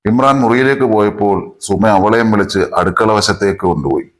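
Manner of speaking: plain reading voice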